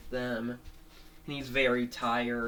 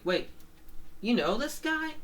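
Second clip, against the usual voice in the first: high, incredulous cartoon voice; surprised question; 11 semitones higher than usual. Axel asking a friend about Robert, high surprised question. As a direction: shoot the pitch right up in disbelief.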